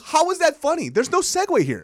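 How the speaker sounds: retold exasperated outburst, pitched high; baffled, high-pitched complaint. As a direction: push it up high in disbelief. Exasperated reaction retold to story.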